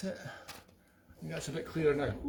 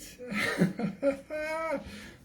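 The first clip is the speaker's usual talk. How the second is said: laugh; 9 semitones higher than usual. Real, not acted. Laughing at his own singing.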